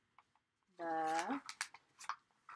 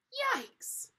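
very high, loud yelp; screamed; 6 dB louder than usual. A narrator's reading. Both children step into freezing water, screaming. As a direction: yelp as if hit by icy water. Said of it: "they both screamed".

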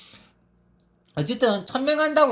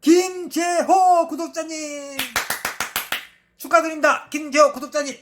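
hyped giveaway announcement; 3 semitones higher than usual. Real, not acted. Announcing winner.

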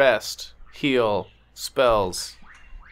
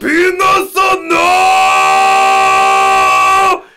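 screaming much higher and louder, drawn out; hammed-up terrified shriek; nightmare scream; 9 dB louder, much slower than usual. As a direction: shriek in horror at the dream. Character wakes from nightmare screaming.

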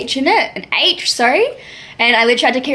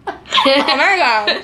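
slow words through laughter; laughing; 3 semitones higher, much slower than usual. Real, not acted. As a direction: giggle out the words. Laughing at partner's attempt.

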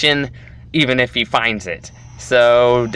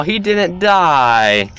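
drawn-out, slower in shock; surprised slow disbelief; 5 semitones higher, 3 dB louder, much slower than usual. Real, not acted. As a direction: stretch it out in disbelief. Victim survived the pit.

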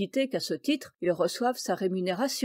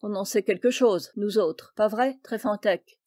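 ordinary delivery, nothing marked

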